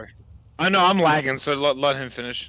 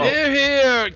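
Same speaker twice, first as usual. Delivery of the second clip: loud raised-glass cheer, pitched up; toast cheer; 12 semitones higher, 7 dB louder than usual. Acted. Player's character toasting the new officials, loud cheer. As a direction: call it out to the whole table.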